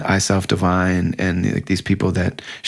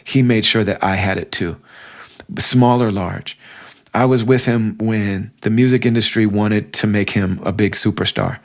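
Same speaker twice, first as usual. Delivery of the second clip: normal talking voice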